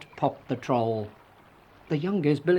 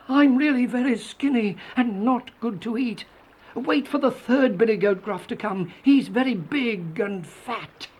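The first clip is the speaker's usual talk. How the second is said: raised-pitch goat pleading its case; higher storybook goat voice; middle goat voice; 8 semitones higher than usual. A narrator's reading. Second goat pleads not to be eaten, high voice. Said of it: "said the second billy goat Gruff".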